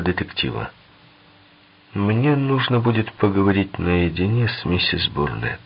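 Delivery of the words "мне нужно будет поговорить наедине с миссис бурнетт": plain reading voice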